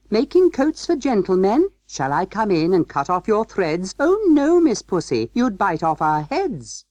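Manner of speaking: high, lilting rhyme in mouse voices; little mice's tune. A narrator's reading. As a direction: chant a nursery rhyme as playful little mice. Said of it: "little mice only laughed and tried another tune".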